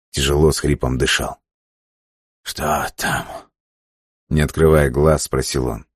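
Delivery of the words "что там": weak hoarse voice. A narrator's reading. Sick man asks weakly.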